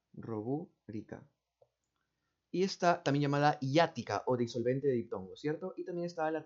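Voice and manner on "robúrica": slow careful spelling-out. Shown as a demonstration. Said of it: "A ver, disculpen".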